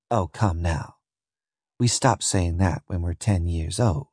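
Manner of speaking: low, soft dismissal; weary scorn. Acted. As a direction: brush off his outburst quietly. Scornful slave woman answers his shouting.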